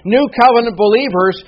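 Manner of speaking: rising fervent sermon voice; preaching at full force. Acted. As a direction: drive it home from the pulpit.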